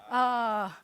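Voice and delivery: a long, loud, open "Ah" held out; open ah vowel. Shown as a demonstration. Teacher drilling the class on the Hebrew vowel, loud open "Ah". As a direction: call out "Ah" clearly for the drill. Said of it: "quiz, go".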